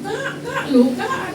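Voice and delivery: high, anxious calling out to her sister; alarmed friend. Retold or imitated. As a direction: call Kak in a sudden worried pitch. Friend alarmed hearing her cry. (panggilan cemas bernada tinggi)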